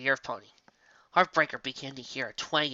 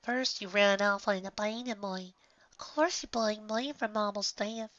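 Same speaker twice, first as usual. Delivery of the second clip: put-on young filly voice; 8 semitones higher than usual. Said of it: "she heard Apple Bloom's voice".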